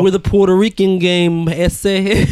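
slow, laughing Mexican gangster caricature; mock Mexican gangster accent. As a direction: drawl it out while cracking up. Mocking the accent again, laughing.

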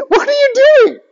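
imitating the tall bully, high and sneering; mocking bully. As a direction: squeak out the big kid's taunt mockingly. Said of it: "He goes".

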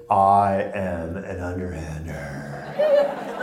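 very slow, stretched-out I am an underhander with a laugh; drawn-out confession. Retold or imitated. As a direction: drag every word out, half laughing. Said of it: "she goes".

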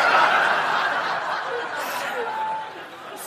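silent wheezing laugh; helpless airy laughter; real laugh with no voice; breathless laugh. Real, not acted. Laughing at her own story.

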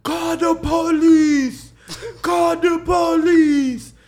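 high, slow mock yell. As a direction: cry out for the police, hammed up. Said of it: "She kept yelling that shit".

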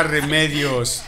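normal talking voice

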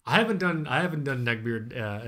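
normal talking voice

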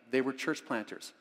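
ordinary talk

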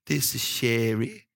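slow, drawn-out self-introduction; imitated woman caller. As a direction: draw the words out, mimicking the caller. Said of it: "this lady said that".

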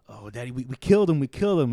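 high, loud panicked wail; mock panic, she can't believe it. Girl panicking to her pimp over the dead john, frantic. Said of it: "still freaking out in the corner".

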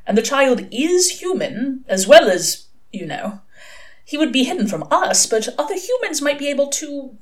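lightly hesitant angel voice; Aziraphale. A narrator's reading. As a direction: reason it out as a high-voiced angel.

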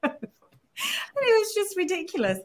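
high, slow, teary laugh on the words; real laughing tears. Real, not acted. Guest telling hosts her story, laughing through real tears. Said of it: "Sorry, I don't know where that came from".

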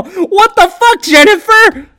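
slow, loud, high disbelieving yell; outraged gambler yelling at the screen; furious outburst. Retold or imitated. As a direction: yell at the player who just lost you money. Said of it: "I was so mad. I go".

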